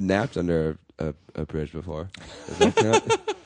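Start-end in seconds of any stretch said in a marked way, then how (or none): 2.5-3.4 sudden squeak up high; squeaky high. Real, not acted. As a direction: pop way up in pitch. Squeaky joke question.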